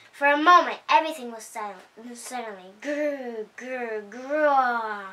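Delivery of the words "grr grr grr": soft growl. A narrator's reading. Sudden growling again.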